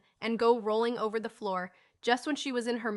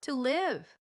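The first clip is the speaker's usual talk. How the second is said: lightly voiced small girl, higher; little girl echo; 4 semitones higher than usual. A narrator's reading. Little girl on the old man's lap echoes dreamily. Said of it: "echoed Phronsie".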